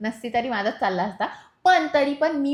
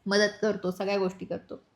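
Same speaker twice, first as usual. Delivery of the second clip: ordinary talk, nothing marked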